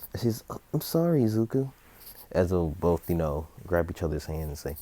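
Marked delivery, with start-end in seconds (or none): 0.5-1.7 high-pitched little apology; apologetic child. Acted. Bakugo to Izuku after burning him, apologetic child. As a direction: play a sorry kid, pitched well up. Friend apologizes in acted scene.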